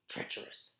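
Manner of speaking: hushed, breathless single word; whispered verdict. Acted. Mister Beaver whispering his verdict on Edmund. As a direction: whisper it like a dark secret.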